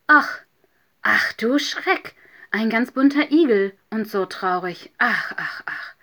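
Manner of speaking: loud, dismayed exclamation; startled concern. A narrator's reading. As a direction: exclaim in shock at a sad little stranger. Another hedgehog exclaims at the sad one. (erschrocken und mitfühlend)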